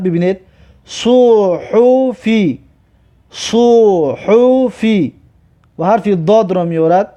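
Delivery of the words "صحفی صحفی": very slow, held syllables; drawn-out model reading. Shown as a demonstration. Teacher models a word for learners, very slow and drawn out. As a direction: stretch "صحفی" syllable by syllable.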